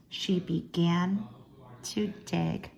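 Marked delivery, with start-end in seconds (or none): none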